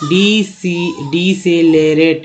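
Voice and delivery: "decelerate" drawn out slowly, each syllable separate; stretched single-word demo. Shown as a demonstration. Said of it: "एक के बाद ये देखिए".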